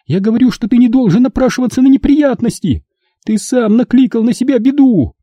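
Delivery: high shout stressing не. Acted. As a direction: cut him off with a shout. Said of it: "закричала она". (мать кричит на сына)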